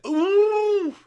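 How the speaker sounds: loud, very high mocking whoop; high "ooh". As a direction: let out a long, loud, high-pitched ooh. Mock reaction to cheating jibe.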